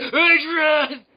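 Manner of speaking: loud, high slurred yell; hammed-up catchphrase scream; Sylvester Stallone. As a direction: holler it like a punchy boxer. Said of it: "starring Sylvester Stallone".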